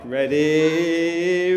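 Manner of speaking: long drawn-out call, gradually rising; Jerusalem wave demo. Shown as a demonstration. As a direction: stretch it into a swelling crowd-wave sound. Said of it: "here's what a Jerusalem wave".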